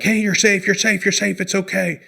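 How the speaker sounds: high, rushed panicky "you're safe"; anxious friend saying it's okay but sounding scared; high-pitched nervous reassurance; frantic reassurance. Shown as a demonstration. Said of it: "your voice and your actions are not communicating safety".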